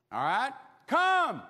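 loud, slow, high summons; commanding call. Acted. Jesus summoning Peter, slow and loud. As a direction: call him out onto the water.

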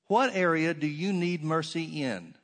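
normal talking voice

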